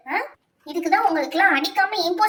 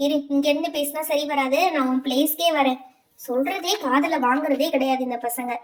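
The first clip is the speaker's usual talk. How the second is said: usual speaking voice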